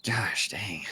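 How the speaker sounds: whispered curse. Real, not acted. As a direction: breathe it out under your breath. Muttering over a bad draw.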